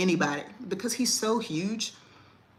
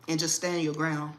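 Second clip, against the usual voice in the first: usual speaking voice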